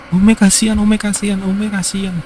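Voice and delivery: put-on a small boy whimpering pity; crying child. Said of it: "dia nangis".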